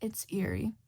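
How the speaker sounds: ordinary delivery, nothing marked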